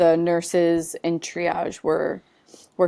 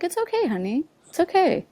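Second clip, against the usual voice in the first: comforting nurse; 11 semitones higher, faster than usual. Retold or imitated. Nurse hugs the crying mother, high and tender. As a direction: coo it's okay like a kind nurse. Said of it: "she's like".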